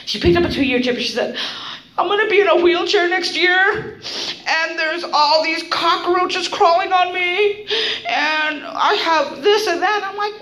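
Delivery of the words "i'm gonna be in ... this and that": whiny complainer. Retold or imitated. Woman moaning to the recovery room about her troubles, mock whining.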